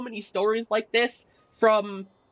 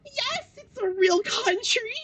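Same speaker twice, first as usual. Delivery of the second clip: much higher exasperated wail, slow; exasperated cry; 12 semitones higher, slower than usual. Retold or imitated. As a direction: cry out in disbelief at him. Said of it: "I was like".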